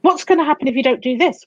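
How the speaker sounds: gasping, scolding voice in the head; critical inner voice. Acted. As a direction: nag with a gasp, piling up reproaches. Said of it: "recognize that voice in the back of their head".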